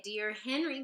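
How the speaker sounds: ordinary delivery, nothing marked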